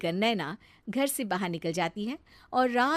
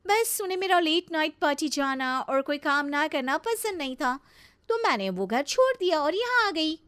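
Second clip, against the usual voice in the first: higher-pitched, mildly huffy complaint; petulant bahu; 7 semitones higher than usual. Acted. Naina tells bhabhi why she left her in-laws, petulant. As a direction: justify yourself with a slight pout. Explains leaving husband's house.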